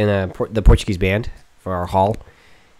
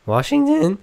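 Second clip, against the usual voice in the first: voice crack; 11 semitones higher, slower than usual. Shown as a demonstration. Speaker replays his slip on Washington, voice cracking. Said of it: "for that voice crack".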